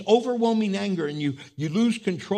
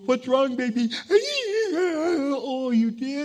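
higher-pitched, concerned parent voice; mother soothing child; 8 semitones higher, 5 dB louder than usual. Acted. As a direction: coo sympathetically to a crying little one. Mother answers her crying child.

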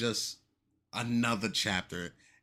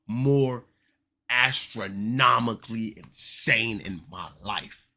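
outraged emphasis; 7 dB louder, slower than usual. Acted.

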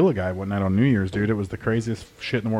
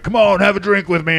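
pushy customer; 8 semitones higher, 5 dB louder than usual. Retold or imitated. Customer urging a drink.

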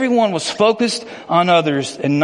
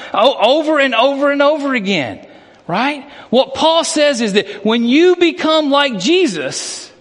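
impassioned preaching; 7 semitones higher than usual. Acted. Pastor urging the congregation to leave old sins, fervent. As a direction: build it up like a preacher on fire.

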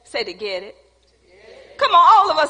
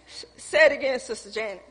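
ordinary talk, nothing marked

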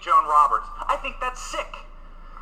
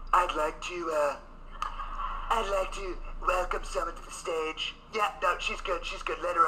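a soft, quieter take on Trump's podium voice; Trump impression; 5 semitones lower, 9 dB quieter than usual. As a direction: slip lightly into Trump welcoming a guest, softer. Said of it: "Just go".

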